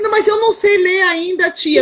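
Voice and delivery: put-on high little girl's plea; child pleading with her teacher. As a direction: beg like a small child.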